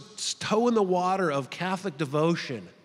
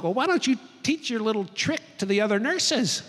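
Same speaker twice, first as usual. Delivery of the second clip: higher-pitched, pointed suggestion; tart retort; 5 semitones higher than usual. Retold or imitated. Mom to nurse about her little trick, tartly. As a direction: imitate a mom's peeved comeback.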